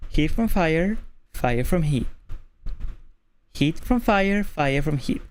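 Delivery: warm-up phrase. Shown as a demonstration. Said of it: "Here's what I do".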